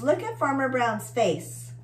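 ordinary delivery, nothing marked

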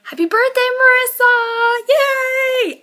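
high, loud, bursting with joy; excited birthday cheer. Real, not acted. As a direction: cheer it out for your friend. Birthday greeting shouted to friend.

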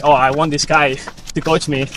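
usual speaking voice

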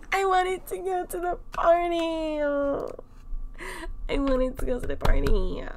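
high, whining wail; high mock-sobbing complaint; whiny mock cry. Real, not acted.

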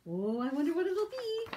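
plain reading voice

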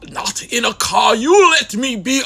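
usual speaking voice

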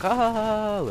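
hammed-up wow; mock gasp of amazement. Imagining a friend seeing the clip, mock amazed "Oh!". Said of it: "Then you can be like".

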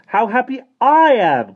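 high, very loud cry with a long stretched "I"; happy cry. A narrator's reading. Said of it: "cried Michael".

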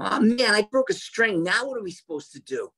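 high, whiny complaining musician; whiny young self. Retold or imitated. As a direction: whine it like a spoiled young guy. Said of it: "I was real whiny and complainy".

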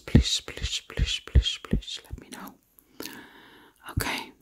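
normal talking voice

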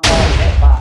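sudden loud complaint; loud outburst. Real, not acted. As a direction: blurt it out loud. Got hit in game.

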